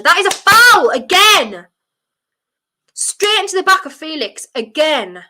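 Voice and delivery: real outraged shout, pitched high and drawn out. Real, not acted. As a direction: shout in protest at a foul. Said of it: "I'm very sorry for shouting".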